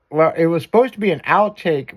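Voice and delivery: usual speaking voice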